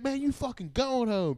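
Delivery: hammed-up indignant scolding; mock cussing out. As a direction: imitate an exasperated detainee telling someone off. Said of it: "He starts cussing me out".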